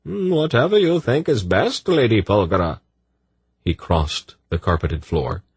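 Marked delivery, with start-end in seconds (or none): 0.0-2.8 higher silky male voice; smooth eunuch voice. A narrator's reading. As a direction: purr it politely. Sadi voiced.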